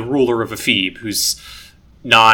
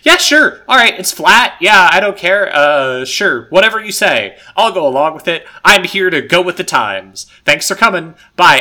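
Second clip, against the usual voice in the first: loud, high, couldn't-care-less brush-off; dismissive; 5 semitones higher, 6 dB louder than usual. Retold or imitated. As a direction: wave them off carelessly. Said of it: "he's just like".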